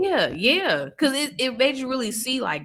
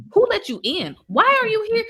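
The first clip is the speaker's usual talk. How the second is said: rising, higher-pitched mock demands; acted interrogation; 12 semitones higher, 4 dB louder than usual. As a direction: fire indignant questions at an imagined intruder. Acts out questions she wanted asked.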